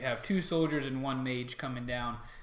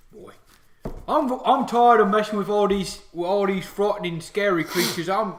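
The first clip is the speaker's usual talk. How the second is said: loud, raised orc lament; orc soldier; 7 semitones higher, 11 dB louder than usual. Acted. Orc petting a dog, missing ordinary pets, orc voice. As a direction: complain heartily as a homesick orc.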